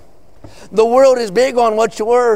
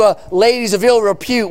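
normal talking voice

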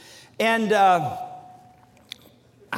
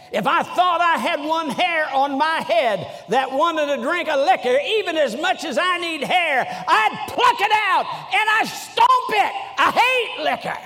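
high, fiery Southern pulpit tirade; Southern accent; 9 semitones higher, 3 dB louder than usual. Retold or imitated. Old preacher raging against drink, Southern accent tirade. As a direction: build to a shout in a broad Southern accent. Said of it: "trying to use my su-, best Southern accent".

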